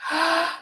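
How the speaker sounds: quick high intake of breath; sharp excited gasp. Real, not acted. Vlogger reads a new comment, sharp gasp. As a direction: gasp in sudden delight.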